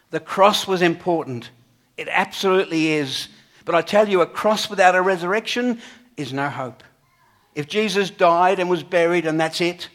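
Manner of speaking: emphatic preaching. Acted. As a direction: press the point home hard. Preacher stresses resurrection over cross.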